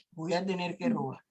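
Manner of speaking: low, quiet, defeated admission; a hushed, heavy-hearted decision; resigned confession. Acted. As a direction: confess it softly, cornered. Teacher role-plays a desperate mother.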